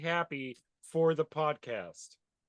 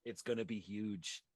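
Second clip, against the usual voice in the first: Trump voice; 4 semitones lower, 5 dB quieter than usual. Retold or imitated. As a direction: stretch it out like Trump. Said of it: "sound like Trump".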